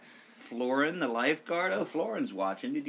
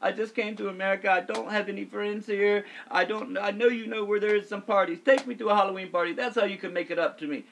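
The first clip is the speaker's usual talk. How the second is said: higher-pitched imitation Romanian accent; Romanian lifeguard; 7 semitones higher than usual. Lonely lifeguard asks coworker for a party, imitated higher Romanian accent. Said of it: "Finally, he's like".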